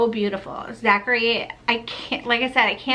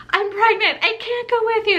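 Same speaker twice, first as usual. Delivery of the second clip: much higher, tearful breaking cry; bawling confession; 12 semitones higher, 4 dB louder than usual. Retold or imitated. Telling her husband in the car, breaking into tears. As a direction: blurt it out as the tears come. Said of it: "I just started bawling".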